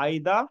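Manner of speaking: "either" in two parts, EYE-da, no r; British pronunciation. Shown as a demonstration. Teacher sounding it out after spelling in Bengali, "either" as EYE-da. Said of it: "আই দয় জফল আকারে দা".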